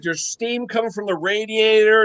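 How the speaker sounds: normal talking voice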